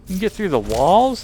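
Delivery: loud outraged yelp; startled shout. Real, not acted. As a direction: shout in shocked protest. Mice pass through walls.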